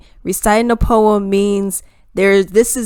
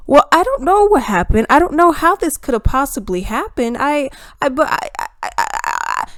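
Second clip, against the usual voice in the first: higher, stammering mock helplessness; mock flustered excuse; 5 semitones higher than usual. Politician excusing broken promises to voters, mock flustered. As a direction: sputter excuses as a mocked politician.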